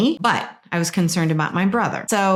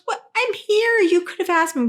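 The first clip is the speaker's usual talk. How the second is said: much higher, pleading brother; upset brother; 13 semitones higher than usual. Retold or imitated. Brother to sister hurt by her, high and pleading. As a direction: protest that he was right there. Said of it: "And he goes".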